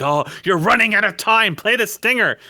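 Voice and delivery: high, loud sarcastic mock of a TV producer; sneering parody of a producer cueing the music. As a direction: ham it up like a smug showrunner calling for drama.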